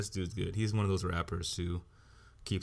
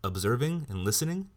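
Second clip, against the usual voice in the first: louder, higher indignant question; irritated raised demand; angry challenge; 6 semitones higher, 4 dB louder than usual. Real, not acted. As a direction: throw it at them, offended.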